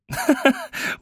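very high-pitched burst of laughter; amused laugh. A narrator's reading. Woman reacting to the boy's joke, high amused laugh.

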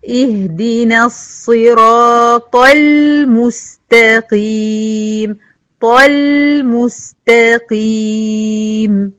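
slow, drawn-out chanted recitation; slow Quran recitation. Shown as a demonstration. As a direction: recite it in measured tajweed. تلاوة مجودة. (تلاوة مجودة بطيئة)

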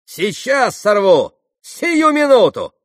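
high, loud, drawn-out cries; shrill-edged defiant outburst, slowing on each word; ringing cry. A narrator's reading. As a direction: cry out with rising urgency. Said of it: "звонко прокричала она".

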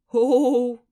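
loud, high theatrical "oh"; mock surprise. A narrator's reading. Natasha teasing Lera, theatrical loud surprise. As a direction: overplay the surprise. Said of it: "картинно удивилась Наташка".

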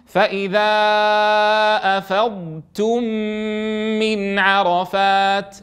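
slow, long-held recitation; Quran recitation. Shown as a demonstration. Teacher to students, reciting the verse slowly. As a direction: draw out each word of the verse.

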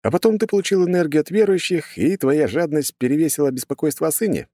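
high-pitched, needling jibe; teasing hero. A narrator's reading. Hero teases the god about greed, needling. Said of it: "поддел я Урфина".

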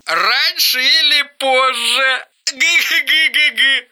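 a crazed brother's guffaw; cackling laugh. Acted. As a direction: gloat and cackle down the line. Eric cackles on phone.

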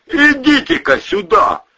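high, insistent holler; calling from afar. A narrator's reading. Someone by the jeep summoning the men, calling out. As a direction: call them over from a distance. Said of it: "долетело от джипа".

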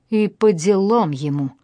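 usual speaking voice

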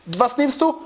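ordinary talk

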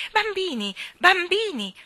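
high, loud summons; mother calling the children sharply; shouting from the stairs. A narrator's reading. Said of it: "sentirono un vociare sulle scale".

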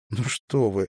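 ordinary delivery

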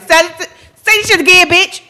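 loud, high, fierce comeback; loud high defiant retort with a laugh; defiant inner shout. Retold or imitated. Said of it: "My brain will be like".

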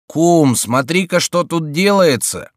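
high, slow and loud exclamation; alarmed cry. A narrator's reading. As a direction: call out to your friend in alarm. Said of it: "вскричал Митон".

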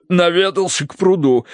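colonel answering after a coughing fit; through a cough. A narrator's reading. Colonel explains his outing to his wife, coughing. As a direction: push the words out after coughing. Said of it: "ответил полковник сквозь кашель".

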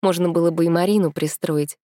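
usual speaking voice

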